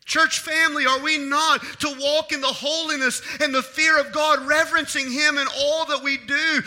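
loud, high, driving sermon delivery; impassioned preaching. Real, not acted. Preacher urging church to fear God, impassioned preaching. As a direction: preach it with full force and weight.

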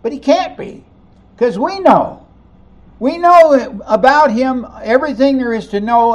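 indignant know-it-all townsfolk; mock insistence. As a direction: play the crowd certain they know him. Voicing crowd's objection.

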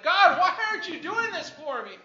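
upset. Retold or imitated. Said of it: "I was so upset".

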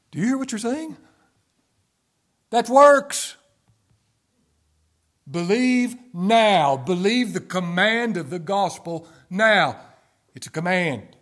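loud, high rebuke of the excuse; fiery preaching. Real, not acted. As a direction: thunder it at the congregation. Preacher rebuking works-based excuses.

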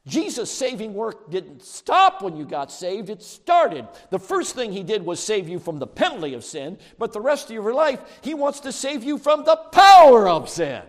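loud, high preaching building to a shout; preaching at full force. Real, not acted. Preacher on Jesus' saving work, building to a shout. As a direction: drive each phrase harder to a roaring finish.

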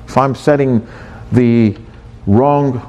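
ordinary delivery

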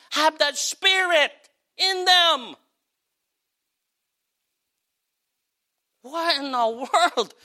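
incredulous what. Real, not acted. Preacher outraged at quitting attitude.

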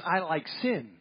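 "sin" said where "fish" was meant, no S sound; S dropped. Retold or imitated. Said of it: "since she didn't pronounce the S".

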